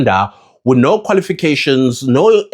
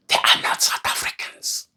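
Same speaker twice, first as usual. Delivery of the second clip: whisper; 8 dB quieter than usual. Real, not acted. Pointed rhetorical question to viewers.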